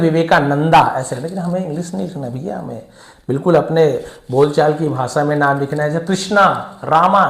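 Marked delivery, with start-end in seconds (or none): 0.0-0.8 विवेकानंदा with a long aa added at the end; Anglicised विवेकानंदा, ending in -nandaa; English-style ending. Retold or imitated. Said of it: "English वाले क्या करते हैं". 6.1-7.3 कृष्णा, रामा with a long final aa; Anglicised कृष्णा, रामा, endings stretched to -aa; English-style endings. Retold or imitated. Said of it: "ऐसे कर देते हैं".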